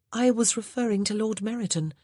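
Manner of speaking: put-on a young lady at a loss; Grace, the new lady of the house. A narrator's reading.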